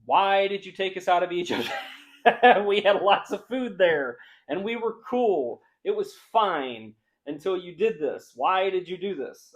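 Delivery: hammed-up whiny complaint; whining Israelites. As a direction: mimic the grumbling complainers, amused. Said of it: "They're just like".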